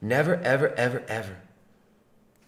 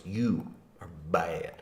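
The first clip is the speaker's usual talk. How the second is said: normal talking voice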